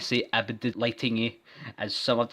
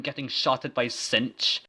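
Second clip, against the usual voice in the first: usual speaking voice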